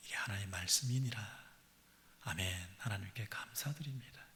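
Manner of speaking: whispered amen; hushed whisper. Acted. Preacher closes the scripture reading in a whisper. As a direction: drop to a breath for the amen.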